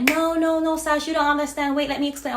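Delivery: high, loud frantic pleading; panicked friend pleading. Retold or imitated. Friend pleading with her to let her explain, frantic.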